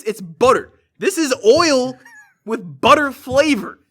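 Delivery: loud, high, emphatic indignation; hammed-up outraged clerk; exasperated rant. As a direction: rail at an impossible customer.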